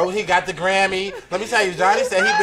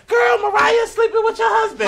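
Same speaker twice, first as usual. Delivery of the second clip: high shouted blurt; 6 dB louder than usual. Retold or imitated. Acting out blurted gossip.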